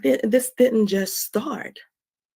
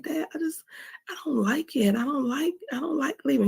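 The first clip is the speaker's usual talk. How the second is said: put-on higher, hushed little-girl plea; child self pleading; 6 semitones higher than usual. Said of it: "And I was like".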